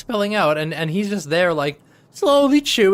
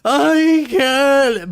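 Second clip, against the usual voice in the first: muffled; 5 semitones higher, 5 dB louder than usual. Retold or imitated. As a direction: mouth full of chocolate. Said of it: "she's muffled".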